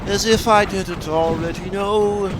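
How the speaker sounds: sarcastic. Acted. Sarcastic aside while gaming.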